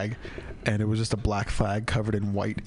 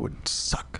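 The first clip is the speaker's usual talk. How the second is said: whisper; 4 dB quieter than usual. Real, not acted. Whispered aside.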